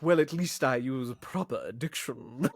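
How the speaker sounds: slow, over-proper snob voice; mock-elitist retort with careful diction. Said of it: "like, elitist and weird".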